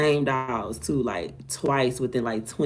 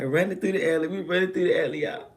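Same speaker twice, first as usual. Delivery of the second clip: usual speaking voice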